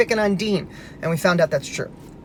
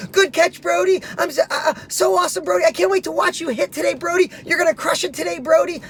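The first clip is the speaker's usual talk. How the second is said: put-on much higher, eager boy's voice hyping up a friend; excited kid cheering; 9 semitones higher than usual. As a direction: play the sorry boy now cheering his teammate on, high and bright with eagerness. Boy cheering on teammate Brody.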